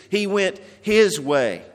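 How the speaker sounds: "his" stressed and stretched, then falling on way; emphasis on his. Shown as a demonstration. Preacher repeating the verse to show the congregation its emphasis, stress on "his". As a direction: lean firmly on the word "his". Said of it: "the emphasis is on his".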